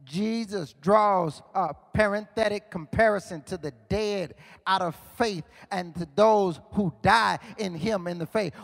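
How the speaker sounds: ordinary talk, nothing marked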